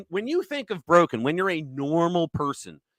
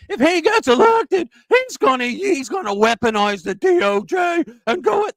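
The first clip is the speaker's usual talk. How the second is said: much higher and louder, a shrieking mock of a panicked convention speaker; mocking the DNC woman; 10 semitones higher, 8 dB louder than usual. Host mimics a DNC convention speaker, much higher, loud shrieking mockery. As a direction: screech her warning like a hysterical delegate. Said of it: "That's how she sounded, by the way. I'm doing that".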